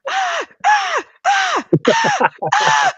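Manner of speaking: very high, loud mock gasps of terror; imitated terrified gasps. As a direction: mimic someone frozen in fear, squealing short gasps. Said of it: "see the terror on her face".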